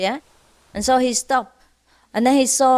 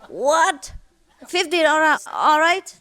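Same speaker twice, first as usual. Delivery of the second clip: higher, bargaining in mock disbelief; haggling; 6 semitones higher than usual. Drowning miser haggles with the boatman, high mock bargaining. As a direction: haggle the price down, pitched up.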